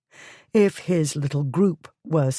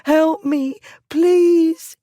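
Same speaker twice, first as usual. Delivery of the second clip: high, louder sobbing plea; 13 semitones higher, 6 dB louder, much slower than usual. A narrator's reading. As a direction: beg through sobs. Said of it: "Hannah sobbed".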